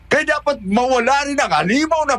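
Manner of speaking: high, forceful grief-stricken anger; impassioned, shouted vow of revenge; vengeful anger. Acted. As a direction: cry out for the killer to pay. Vows to destroy child's killer.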